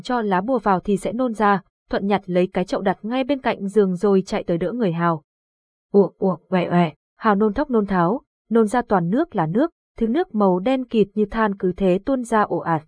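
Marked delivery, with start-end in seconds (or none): 5.9-6.9 mimed gagging retch; retching noises. A narrator's reading. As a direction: heave the sounds out like throwing up. Boy vomiting sound.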